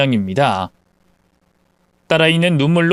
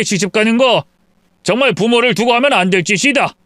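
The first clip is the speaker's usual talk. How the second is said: stern father; 4 semitones higher than usual. Acted. Father lectures daughter about filial duty, stern. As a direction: lay down the law.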